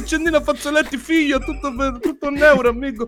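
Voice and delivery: put-on high-pitched Moroccan street vendor accent. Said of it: "Gli indiani non parlano come i marocchini". (venditore ambulante con accento marocchino)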